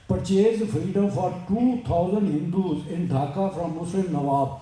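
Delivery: slow, measured English recitation; formal read-aloud. Acted. Speaker reading a great woman's story to listeners, slow formal read-aloud. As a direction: read it out word by word, slowly.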